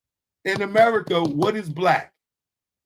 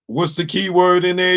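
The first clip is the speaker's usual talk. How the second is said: ordinary delivery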